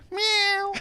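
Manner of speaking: high little cat meow. Pretend. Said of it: "what's your sound".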